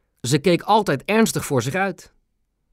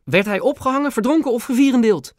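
high, eager questions; excited boy; 10 semitones higher than usual. A narrator's reading. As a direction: rattle off the gory options with glee. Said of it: "vroeg Ben opgewonden".

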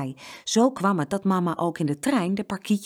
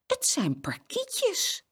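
put-on much higher, slow, old lady voice; trembling old lady; 13 semitones higher, 4 dB quieter, much slower than usual. A narrator's reading. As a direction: let an old lady's voice tremble. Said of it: "haar vingers trilden".

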